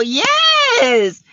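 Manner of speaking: high joyful shout of yes; excited yes. Real, not acted. Host cheering her viewers for reaching the goal, excited shout.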